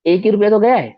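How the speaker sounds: put-on sassy child shrugging off the loss; cheeky child. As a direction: toss it off like a sulky boy. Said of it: "ऐसा, ऐसा उसने बोला".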